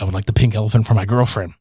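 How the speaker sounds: low, fast, mock-formal request; stiff polite request. The hero asks the carnival man for the prize, low and fast. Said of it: "he's like".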